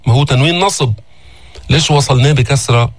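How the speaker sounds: normal talking voice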